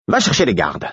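dry, no-nonsense order; curt order. A narrator's reading. Murfess ordering the fool to get the guards, curt. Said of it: "dit sèchement Murfess".

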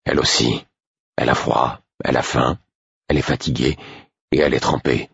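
low, dry retort in short phrases. A narrator's reading. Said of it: "d'un ton plus sec". (ton sec, voix grave)

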